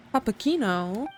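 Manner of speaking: normal talking voice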